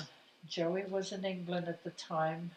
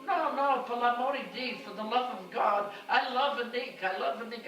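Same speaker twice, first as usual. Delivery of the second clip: high, loud, insistent Italian mama; Italian mother pleading, high and loud; protesting mother; 7 semitones higher, 7 dB louder than usual. Retold or imitated. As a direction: swear it loudly with Italian accent. Mama protesting she loves Nick.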